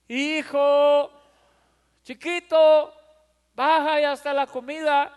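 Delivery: put-on sweet loud call to the kid; mother calling. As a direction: call the little one down, still sweet. Mother calls son to dinner.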